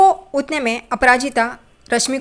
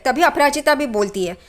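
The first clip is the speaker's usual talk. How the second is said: ordinary delivery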